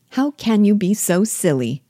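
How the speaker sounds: a mother's exasperated outcry, understated; mild exclamation. A narrator's reading. Mother scolding her daughter for planning to walk, mildly exclaiming. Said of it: "cried her mother".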